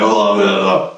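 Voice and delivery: very low, garbled mock voice; low mock gibberish; unintelligible bloke. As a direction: imitate an incomprehensible bloke, deep and mumbled. Imitates bloke he couldn't understand.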